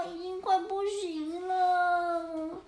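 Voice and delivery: very slow, tearful fading; weak crying cats. Acted. Cats running out of air, slow and tearful. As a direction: barely get the words out.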